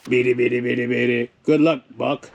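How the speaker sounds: low monotone cartoon robot; robot impression. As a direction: chant it on one low robotic note.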